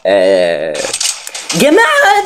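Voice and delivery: normal talking voice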